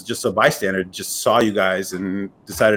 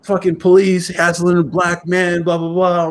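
put-on angry girlfriend; 7 semitones higher, 3 dB louder than usual. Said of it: "she's angry 'cause she's like".

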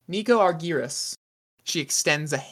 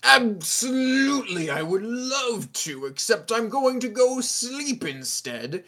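higher, drawn-out, swooping put-on voice; sarcastic rich host; 8 semitones higher than usual. Host declines to join the hunt, theatrically sarcastic. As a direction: stretch it with mock enthusiasm.

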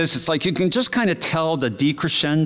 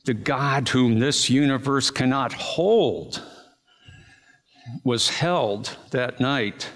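slow, reverent sermon line; solemn preaching; much slower than usual. Acted. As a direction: let each word land with awe.

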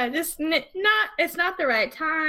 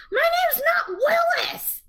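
put-on much higher, slow, shouted indignant kid; angry little boy; slower than usual. The babysat boy objecting to being called Willis, shouting. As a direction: yell it like an offended little boy. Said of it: "gets really mad".

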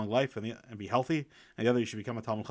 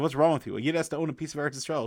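surprised; 5 semitones higher, 4 dB louder than usual. Retold or imitated. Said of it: "he was surprised".

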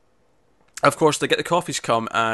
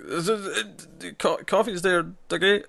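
stammering, higher-pitched imitation of nerves; nervous; 7 semitones higher, slower than usual. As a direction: act flustered, tripping over the words. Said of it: "getting really emotional and nervous".

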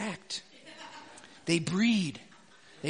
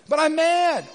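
mock mad; 9 semitones higher, 7 dB louder than usual. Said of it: "man's reply retold".

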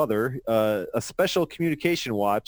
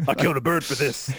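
Mister T voice; faster than usual. Acted. Mister T line read out.

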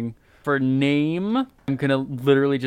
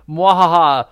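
maniacal laugh; 4 semitones higher, 7 dB louder than usual. Acted. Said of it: "I like a maniacal laugh".

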